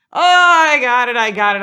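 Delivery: high, loud brush-off; hammed-up cocky reassurance; mock dismissive driver. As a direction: wave off the warning with exaggerated certainty. Imitating driver dismissing her warning.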